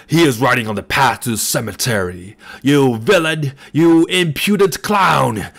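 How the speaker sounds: put-on furious old man bellowing insults after the rider; raving bellow. A narrator's reading. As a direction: rave and curse out of control. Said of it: "still raved and danced".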